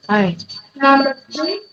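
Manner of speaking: usual speaking voice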